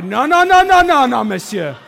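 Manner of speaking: high, loud burst of rapid-fire refusals; yelling protest. Retold or imitated. Pastor stopping a line-cutter in the grocery store, yelling. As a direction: shout him down, pitch shooting up. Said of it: "But I started yelling".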